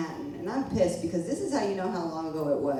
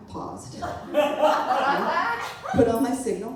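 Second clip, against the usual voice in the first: laughing; 8 semitones higher, 4 dB louder, much slower than usual. Real, not acted. Laughing at driving the stolen cab.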